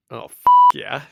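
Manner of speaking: loud, hyped cheer; retold excited reaction. Podcast host reliving the Nick Fury reveal, excited. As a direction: punch it out like a thrilled fan. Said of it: "So I was like".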